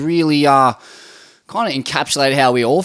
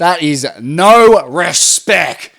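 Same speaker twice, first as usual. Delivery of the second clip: slow, louder, heavily emphasized; mock indignation; 6 dB louder, slower than usual. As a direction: spell out the outrage word by word.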